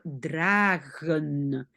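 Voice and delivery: "dragen" slow, with a long a; lengthened word demo. Shown as a demonstration. Teacher lengthens gedrag into its verb, slow demo. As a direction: stretch "dragen" so the G is heard. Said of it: "je peux rallonger".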